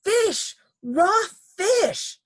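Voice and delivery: high, loud disgusted exclamation; screaming. A narrator's reading. Missus Ralph discovers what she bit, high loud scream. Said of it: "she screamed".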